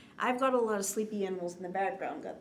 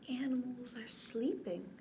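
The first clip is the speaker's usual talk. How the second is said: soft whispered refrain; 6 dB quieter than usual. Acted. Librarian whispering the refrain to drowsy children. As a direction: tell them gently, in a whisper.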